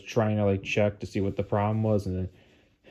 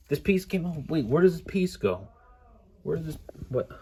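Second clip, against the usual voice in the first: high-pitched, quieter bewilderment; dismayed disbelief; 6 semitones higher, 3 dB quieter than usual. Real, not acted. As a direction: puzzle over the damage in rising distress. Baffled by a broken piece.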